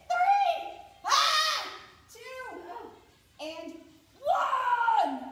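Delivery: loud, straining final reps; shouting the count. Real, not acted. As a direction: yell out the count through effort. Straining through the last reps.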